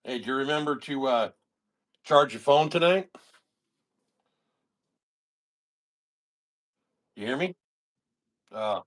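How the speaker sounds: wobbly, warbling phone line; glitchy call audio. Real, not acted. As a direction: voice breaking up over a bad call. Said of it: "You are glitching out a bit".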